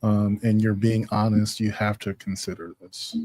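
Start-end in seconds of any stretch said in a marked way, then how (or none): none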